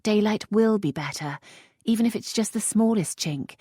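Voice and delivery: a hint of fear; lightly voiced worried lady. A narrator's reading. Lisette asking the earl, mildly anxious.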